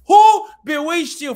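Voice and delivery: slow, high shouted question. Real, not acted. Preacher shouting at African youth, loud slow rebuke. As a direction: cry it out to the crowd.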